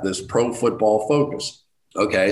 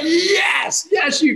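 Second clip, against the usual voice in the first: ecstatic yelling; much slower than usual. Retold or imitated. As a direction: yell like fans who just won. Club members overjoyed at Giants call.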